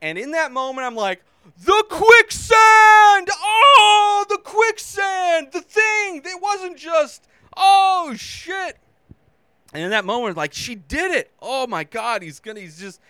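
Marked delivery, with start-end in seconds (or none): 1.6-8.8 high, loud, drawn-out panicked yelling; panicked shout. Retold or imitated. Viewer reliving the quicksand moment, high panicked shout. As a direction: scream it like you're watching it happen.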